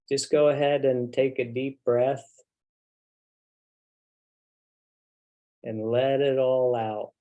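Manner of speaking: unhurried, settling meditation voice with long pauses; guided meditation. Real, not acted. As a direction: guide them gently into the body, leaving long silences. Leads the group into meditation.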